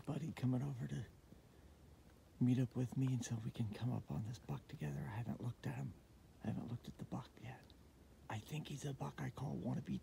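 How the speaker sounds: soft, unvoiced whisper; hunting whisper. Real, not acted. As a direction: keep it hushed in the stand. Hunter whispering in stand.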